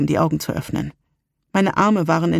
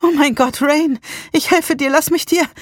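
high, urgent alarm; panicked concern; 10 semitones higher, 3 dB louder than usual. A narrator's reading. Salem offering to help Rain, panicked. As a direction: rush to help, frightened.

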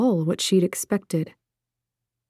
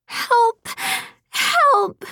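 put-on high, piercing child's cry for help; a small child calling out in terror; much higher child's voice, desperate; terrified child. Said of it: "A child's voice cut the air, full of terror".

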